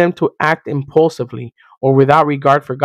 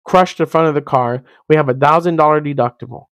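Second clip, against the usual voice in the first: ordinary talk, nothing marked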